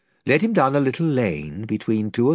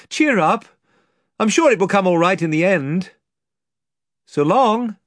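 higher, louder cheery farewell; brave reassurance; 8 semitones higher, 5 dB louder than usual. Acted. Caspian comforting the children as he leaves, cheery.

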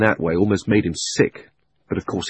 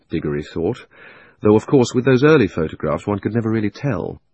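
plain reading voice

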